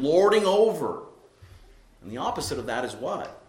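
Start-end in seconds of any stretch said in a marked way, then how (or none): 0.0-1.0 higher and louder, hammering the words home; forceful preaching. Real, not acted. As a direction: build to a peak of indignation. Preacher denouncing domineering church authority.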